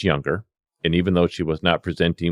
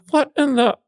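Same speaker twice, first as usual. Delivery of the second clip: stunned disbelief. Retold or imitated. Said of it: "I'm like".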